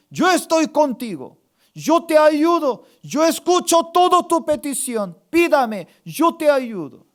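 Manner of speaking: loud, high preaching force; fervent proclamation. Acted. Pastor speaks the Lord's answer to believers, fervent proclamation. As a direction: thunder the promise to the congregation.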